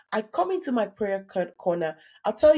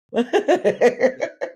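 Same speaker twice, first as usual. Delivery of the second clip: real laugh; 5 dB louder than usual. Real, not acted. Laughing at own story.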